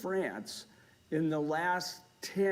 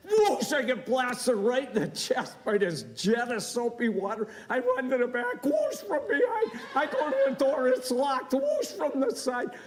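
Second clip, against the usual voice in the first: louder, high, laughing panic; frantic storytelling; 7 semitones higher, 3 dB louder than usual. Real, not acted. Speaker retelling getting blasted in the toilet, loud laughing panic. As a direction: relive the soaking, half laughing. Comic tale of soap blasts.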